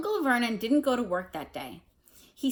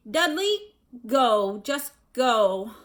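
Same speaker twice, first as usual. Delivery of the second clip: wheezing orders; 3 semitones higher, 6 dB louder, much slower than usual. A narrator's reading. Uncle wheezes at Dudley to leave. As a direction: shoo the boy out between gasps.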